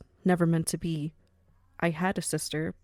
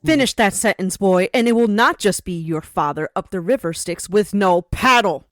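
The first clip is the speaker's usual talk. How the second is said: loud, cold furious warning; loud threat; 9 dB louder than usual. Acted. Madam threatening the insolent young boss, loud and furious. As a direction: make him regret opening his mouth.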